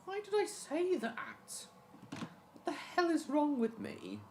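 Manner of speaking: very high, slow squeaky voice; squeaky silly voice. Pretend. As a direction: squeak it out slowly in a cartoon voice. Embarrassed self-mocking comment.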